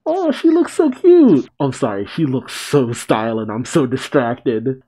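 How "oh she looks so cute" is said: high, loud delighted squeal; gushing. Real, not acted. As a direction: gush over something adorable in a high voice. Gushing over the costume.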